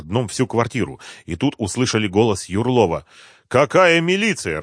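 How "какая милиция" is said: high, slow indignant question; angry. A narrator's reading. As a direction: demand an answer in anger. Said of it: "разозлился Малявка".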